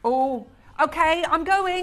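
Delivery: flustered exit. Real, not acted. Told she must leave, rushed.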